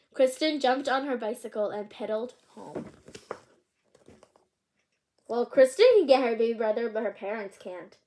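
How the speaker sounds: ordinary delivery, nothing marked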